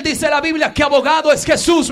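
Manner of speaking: usual speaking voice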